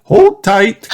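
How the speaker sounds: high, loud call to hold on. Acted. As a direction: call out a warning. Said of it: "cries Mummy Pig".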